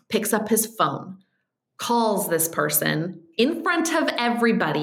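ordinary delivery

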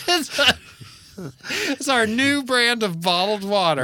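high, bright salesman voice for a fake brand; mock ad pitch. Pitching their fake brand of bottled water, hammed-up ad voice. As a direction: hype it like a commercial.

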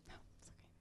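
hushed unvoiced whisper. Turning down wine across the table, hushed whisper. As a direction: whisper so the table can't hear.

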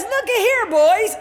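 high, folksy summons; playful paraphrased calling out; Jesus. Acted. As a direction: call the men over, starting way up. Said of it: "He comes and he says".

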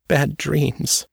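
put-on high, small scared girl's voice; a haunted girl answering in fear; Trisha, frightened girl. A narrator's reading.

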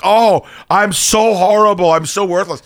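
slow, whiny mock wail of guilt; mock self-pity. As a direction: ham up being pathetic. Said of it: "I'm like".

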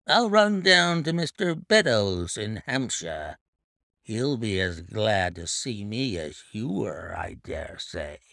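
a hint of a tipsy sneer trailing off; thick half-drunken voice. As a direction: drunk Hudson sneering at the family. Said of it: "in the thick voice of a half-drunken man".

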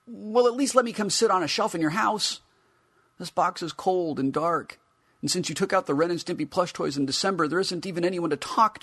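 high, loud whiny figure voice; pleading action figure. Acted. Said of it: "His green eyes implore me".

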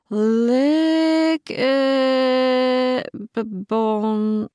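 high, slow, puzzling out faded letters; squinting at a faded box label, hesitant; puzzled reading. A narrator's reading. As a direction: sound out the label haltingly.

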